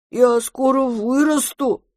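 put-on high, slow childlike promise; drawn-out little-kid voice; promising monster. A narrator's reading. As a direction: vow it eagerly like an oversized baby. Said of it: "пообещало чудовище".

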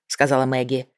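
usual speaking voice

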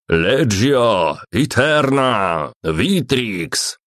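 gruff barked parade orders; barked command. A narrator's reading. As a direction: bark it like a centurion on parade. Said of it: "рявкнул Центурион".

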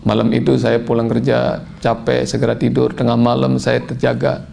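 ordinary delivery

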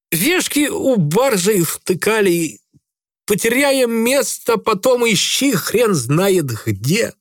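high, slow evasive sailor voice; lying drunk sailor. A narrator's reading. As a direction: fob him off, drawling. Грицай lies, voiced high. (пьяный матрос врёт)

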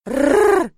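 put-on loud, high toddler roar; fierce growl. Little girl roaring at her father, loud and high. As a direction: growl to scare him off. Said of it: "малышка грозно заорала".

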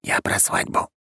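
hushed, voiceless confession; whisper. A narrator's reading. Nervous groom confiding in his friends, whispered. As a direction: whisper it like a secret. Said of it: "Затем прошептал".

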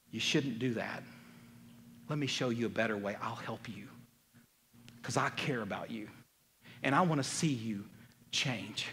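low, soft, unhurried reassurance; gentle caring counsel. Acted. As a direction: offer help tenderly, pitched low and quiet. Models a caring, helpful correction.

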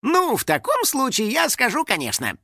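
high, eager-to-please start; ready agreement. A narrator's reading. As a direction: agree at once, all too willingly. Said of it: "сразу согласился финик".

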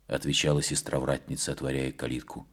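plain reading voice

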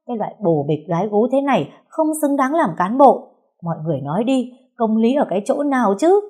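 high, loud wailing complaint; loud public lament. A narrator's reading. Mother wailing to the gathered neighbours about her daughter's cheating husband. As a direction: appeal to the crowd at full indignation. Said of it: "lại càng lu loa lên".

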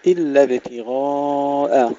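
slow chant, "ابتغاء" full on غا, thin on ء; heavy ghayn, light hamza. Shown as a demonstration. Teacher models the word for pupils, heavy then light. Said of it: "تفخيم الغين والألف ثم ترقيق الهمزة".

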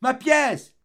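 high, loud desperate shout; a boy shrieking for his money; child's cry. Acted. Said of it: "cria l'enfant".

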